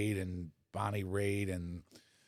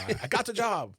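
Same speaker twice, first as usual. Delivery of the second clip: higher, thrilled "I got the job"; excited; 12 semitones higher than usual. Retold or imitated. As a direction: relive the thrill of the news. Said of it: "I called everybody".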